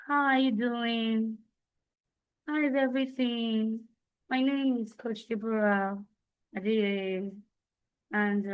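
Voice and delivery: soft, slow and meek, voice fading down; timid quiet voice. Shown as a demonstration. Coach demoing a timid session opening, soft and slow. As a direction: introduce yourself like a shy coach barely heard. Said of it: "imagine I came in that manner".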